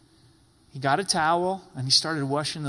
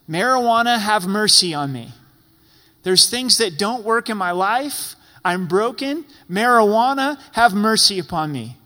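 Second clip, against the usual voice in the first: mock plea to marijuana; 3 semitones higher, 6 dB louder than usual. Preacher mocks someone begging marijuana for help, loud mock plea. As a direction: ham up a desperate plea to a drug. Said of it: "cry out for the mercy".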